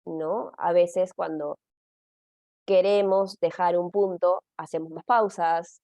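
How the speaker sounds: ordinary talk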